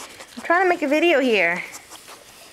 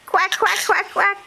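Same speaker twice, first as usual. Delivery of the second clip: put-on high, playful duck quack for the baby; 5 semitones higher, slower than usual. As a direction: quack like a duck to amuse a toddler. Mom quacks for toddler.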